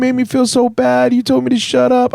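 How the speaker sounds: high-pitched mock crying, loud and drawn out; hammed-up tearful wail. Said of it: "She's crying, going".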